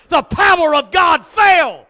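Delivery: loud, slow, laughing shout; shouted praise with a laugh; shouted climax. Acted. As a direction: let it ring with joy.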